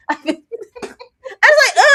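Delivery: high slow laugh into the words. Real, not acted. Telling friend about the show, laughing.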